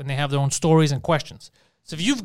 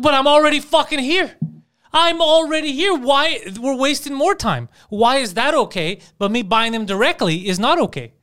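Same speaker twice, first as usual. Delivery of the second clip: loud, high-pitched retold outrage; exasperated protest; 9 semitones higher, 5 dB louder than usual. Buddy arguing with the store clerk over online orders, exasperated. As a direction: imitate a fed-up customer, drawn out and pitched up.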